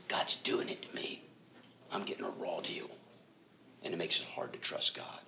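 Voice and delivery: whispered, secret grievance; hushed confession. Retold or imitated. Preacher voicing a believer's hidden grudge against God, whispered. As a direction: breathe it like a shameful secret.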